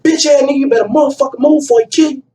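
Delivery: high, fast threatening warning; warning threat. Retold or imitated. Friend warning the doorman, high and fast. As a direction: rush a menacing warning. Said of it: "He said".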